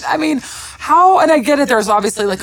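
ordinary talk

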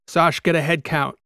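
captain calls to his niece across the deck; shouted order. A narrator's reading. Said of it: "Kikoa shouted to his niece".

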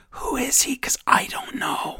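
hushed sarcastic whisper aside; mock conspiratorial whisper. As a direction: breathe it like a secret joke. Mocking aside after Corbin quote.

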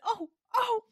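high, pained little cries; retold squeamish dismay. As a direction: wince aloud, re-enacting the horror. Retold squeamish reaction to twisting.

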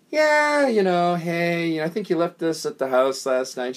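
high drawn-out "hey" with a smile; feigned friendliness. Retold or imitated. Setting up a woman he dislikes, smiling fake friendliness. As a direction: greet them with put-on, smiling innocence. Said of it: "I smile and I go".

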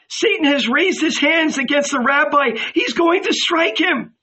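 high, urgent woman's cry; alarmed cry. A narrator's reading. Mary warning that the rabbi is threatened, high alarmed cry. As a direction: cry out the danger at a high pitch. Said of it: "Mary cried".

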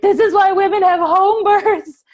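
high, loud, slow words broken by laughter; laughing complaint. Retold or imitated. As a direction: laugh through the complaint in the car. Mid-drive labor complaint, laughing.

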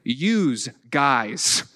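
"use guys", said like "yooz guys", slow; regional "youse". Retold or imitated. Preacher showing Western New York phrase, drawn-out "use guys". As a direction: draw out "use" with a buzzing z. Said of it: "but Y O U S".

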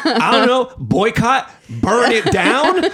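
high, half-laughing exasperation; high hammed-up retold outrage, stretching the end; mock militant. Retold overblown suggestions, laughing.

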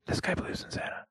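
unvoiced whispered aside; whispering. Real, not acted. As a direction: whisper it behind his back.

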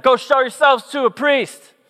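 high-pitched mock shout across a distance; imitated yell. Jesus yelling back at the lepers, imitated yell. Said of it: "he just yells back at them".

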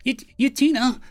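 stammered, high panicked cry; high stammering panic. A narrator's reading. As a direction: panic over his fallen captain. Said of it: "Lim said in a panic".

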